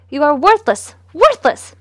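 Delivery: loud, furious spitting of the words; spat insult. Acted. Mother spitting contempt at her son, loud and furious. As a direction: spit the insult at her son, rising to a yell. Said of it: "she spat instead".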